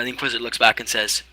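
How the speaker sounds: usual speaking voice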